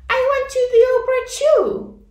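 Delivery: put-on high, fast, eager little girl; little girl Ruby. A narrator's reading. Ruby tells the class, high eager girl voice. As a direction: blurt it like a small girl showing off. Ruby's voice.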